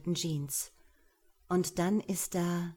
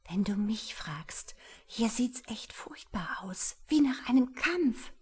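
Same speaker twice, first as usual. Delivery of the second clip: high, urgent frightened whisper; 4 semitones higher than usual. Acted. Wife whispers to husband that it looks like a fight. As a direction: whisper pleadingly, rising in fear. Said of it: "flüstert Samira".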